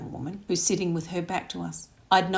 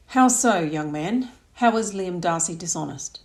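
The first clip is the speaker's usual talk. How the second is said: a louder, probing demand from the father; loud challenge; 5 dB louder than usual. Acted. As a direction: finally speak up to be heard. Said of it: "found enough volume in his voice to be heard".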